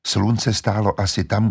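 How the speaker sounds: ordinary delivery, nothing marked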